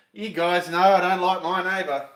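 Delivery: ordinary talk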